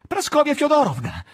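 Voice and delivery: high, loud anxious cry; nervous call. Acted. Ivan calls the nurse in alarm, loud nervous cry. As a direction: call out for help, jittery. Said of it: "Chiamò, cominciando a innervosirsi e a sussultare".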